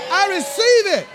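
joyful receiving. Retold or imitated. Preacher voicing a believer joyfully claiming God's gift, building to a shout. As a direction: shout your thanks like a child tearing open a gift. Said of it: "you rip the paper off and you say".

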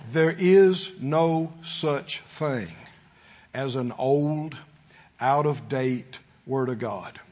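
slow, low, word by word; real slowly. Shown as a demonstration. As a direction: spell it out in separated, weighty phrases. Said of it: "say it again real slowly".

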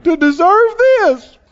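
a hint of a sob, imitating the complainer; mock crying complaint. Imitating someone in trouble, mock tearful whine. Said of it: "they're crying and said".

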